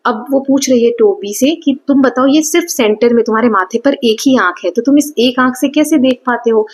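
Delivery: ordinary delivery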